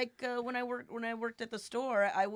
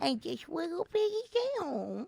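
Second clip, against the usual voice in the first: cutesy voice; 8 semitones higher than usual. Retold or imitated. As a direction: coo it like reading to a toddler. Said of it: "say with a cutesy voice".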